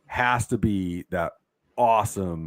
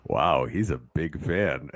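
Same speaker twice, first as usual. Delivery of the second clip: lower, slow impression of the actor, lightly done; imitating the actor; 7 semitones lower, 3 dB quieter, slower than usual. Friend retells the actor's reaction, low and slow. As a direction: quote the actor's deep, drawn-out reaction. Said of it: "he was like".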